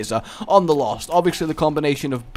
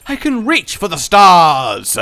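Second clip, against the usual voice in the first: louder and slowed, hammed-up rallying cry; mock heroic declaration; 3 semitones higher, 8 dB louder than usual. Psyching himself up mid-run with a mock heroic cry. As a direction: puff it up like a mock hero.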